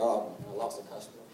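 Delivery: low, soft mock dejection; deflated thought. Nephew hearing his aunt's news, quietly deflated. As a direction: let it sink, as if to yourself. Retold sinking thought about losing customer.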